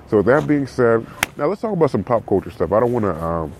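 ordinary talk

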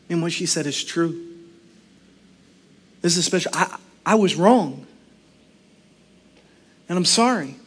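low, hushed, sincere admission; quiet apology with reflective pauses; humbled realization. Acted. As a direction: softly own up to being wrong.

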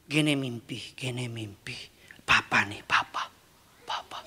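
put-on tender whisper of a father to his child. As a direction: hush it like a secret to a child. Voicing God whispering to child.

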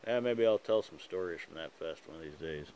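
talking around pipe stem; clenched teeth on pipe; pipe in mouth. Real, not acted. Seen 4: Pipe clenched in mouth throughout; removed before 7.6; refs pipe-free.

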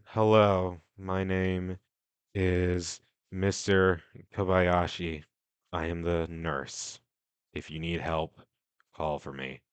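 low, quiet, slow nurse voice; deep, hushed and unhurried, like a creepy nurse. Acted. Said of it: "he says".

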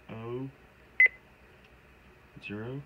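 ordinary delivery

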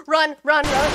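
shouted, frantic commands to herself; yelling in real panic while fleeing; loud desperate shout; panicked shout. Real, not acted. Fleeing monster in horror game.

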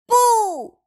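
high, loud, falling cry. A narrator's reading. Rabbit calls after Pooh in his garden, crying out. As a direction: call out a name in alarm. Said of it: "Rabbit cried".

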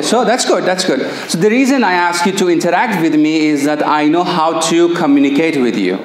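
ordinary delivery

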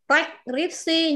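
preskripsi very slowly, puffing the p up front; slow demo. Shown as a demonstration. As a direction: start preskripsi with a breathy p, then rec. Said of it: "không phải là rec luôn, nó là per".

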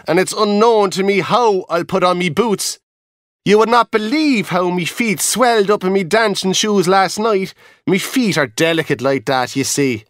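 a high chatty dancer calling down; high-pitched chatty Irish dancer; reel dancer. Acted. Dancer complains of feet.

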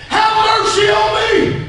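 high, stretched-out beggar's call; pleading cry. Acted. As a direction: cry out slowly for mercy. Blind man begging Jesus for mercy.

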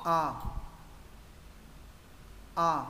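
A said with the mouth opened top to bottom; A with vertical mouth. Shown as a demonstration. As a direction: open tall on each A. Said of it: "mở miệng theo chiều trên dưới". (âm A mở miệng theo chiều trên dưới)

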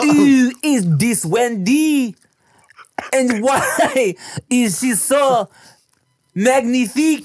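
high, slow mock French accent; French accent, hammed up and slow; exaggerated French accent. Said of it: "doing an exaggerated, uh, accent".

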